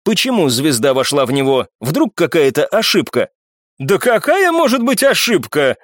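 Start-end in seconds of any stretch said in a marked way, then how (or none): 3.8-5.8 high, indignant outburst; exclaiming. A narrator's reading. Данцев hotly denying any mistake, indignant exclamation. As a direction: cry out in protest. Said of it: "ещё громче вскричал Данцев".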